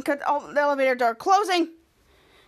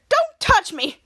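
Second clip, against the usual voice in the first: higher, louder mock outrage; indignant protest; 6 semitones higher, 5 dB louder, much slower than usual. Frankini warning off his captors, indignant protest. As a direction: recoil and cry out. Acted recoil from being touched.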